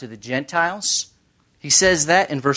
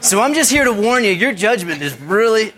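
high, loud, quick mock warning; playfully alarmed, pitched up and hurried; 8 semitones higher, 7 dB louder than usual. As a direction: warn them loudly in jest. Joking warning to the audience.

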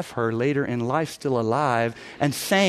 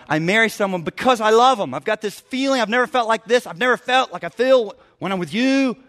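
mock swooning lover, pitched up; lightly acted infatuated husband; gushing lover; 6 semitones higher, 4 dB louder than usual. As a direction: gush like a smitten newlywed. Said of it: "this notion of".

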